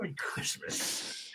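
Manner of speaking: put-on very slow whispered Merry Christmas in a New York Italian accent; hushed, slow New York Italian imitation. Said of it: "Just the way he said that".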